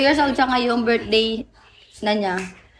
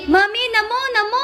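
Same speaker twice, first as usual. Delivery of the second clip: high, pleading baby imitation; mother mimicking her toddler at a party; 11 semitones higher than usual. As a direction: call for mommy like a thirsty toddler.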